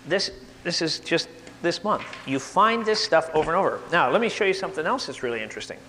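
normal talking voice

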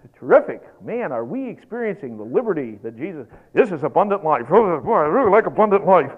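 loud sarcastic grumble about the good life; mock enthusiasm. Preacher playing a miserable believer, heavy sarcasm. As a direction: pretend to love it, clearly fed up.

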